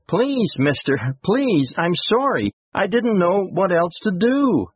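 put-on high-pitched child voice, begging; pleading youngster. A narrator's reading. Boy apologizing to an angry man, pleading. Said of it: "pleaded the youngster".

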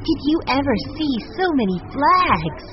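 put-on high-pitched girlish wonder; excited cry. A narrator's reading. Violet seeing the decorated steamer, crying out excitedly. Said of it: "Violet cried".

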